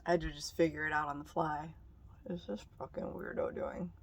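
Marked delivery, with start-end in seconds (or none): 2.2-3.9 hushed, muttering under her breath; muttered whisper. Real, not acted. Whispering to herself about a stranger, muttered. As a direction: whisper it so he can't hear.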